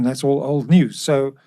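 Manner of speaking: usual speaking voice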